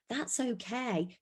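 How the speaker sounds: comfort, letting it land softly; soothing. Shown as a demonstration. Adult normalising a child's feelings, soft and slow. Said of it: "sharing times whereby you've got emotional".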